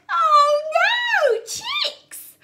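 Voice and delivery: high, loud storybook gasp; mock alarm. Teacher discovering chicks for the children, high and loud. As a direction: cry out in playful alarm.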